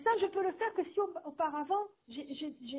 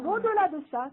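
ordinary delivery

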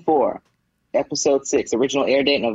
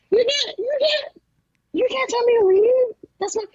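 squeaky, much higher mock indignation; mock drunk outrage. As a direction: play the sloppy drunk refusing to leave, voice up high. Drunk daughter defies funeral ejection.

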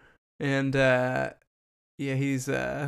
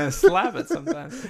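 laughing high voice; 12 semitones higher, 4 dB louder than usual. Retold or imitated. Imitating Sugar's line, laughing.

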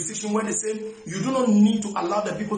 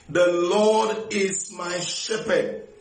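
slow emphatic; slower than usual. Acted. Preacher slows to stress psalm.

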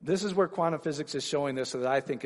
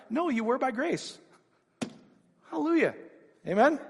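rising, louder preacher's climax, pitch climbing; preaching at full force; 6 semitones higher, 4 dB louder than usual. Acted. Preacher to the congregation, building to a loud climax. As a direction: drive it home to the congregation.

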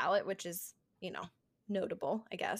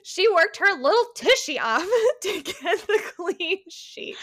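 high, loud, laughing delivery; laughing put-on voice; 8 semitones higher, 7 dB louder than usual. Host performing her co-host's silly line, high and laughing. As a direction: belt it out high through giggles.

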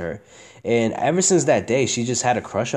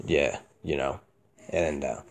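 low, soft, emotionless mumble; monotone; 8 semitones lower, 7 dB quieter, slower than usual. Shown as a demonstration. Showing friends his everyday voice, low monotone. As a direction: drop down into a deadened monotone. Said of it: "I talk like that".

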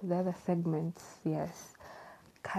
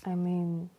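ordinary talk

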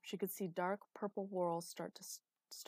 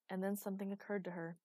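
ordinary delivery

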